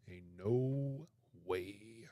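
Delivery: slow, breathless whisper of "Ain't no way", stretched out. Real, not acted. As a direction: breathe "Ain't no way" slowly in disbelief. Horrified at spider photos.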